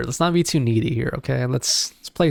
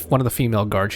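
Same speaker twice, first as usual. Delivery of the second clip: usual speaking voice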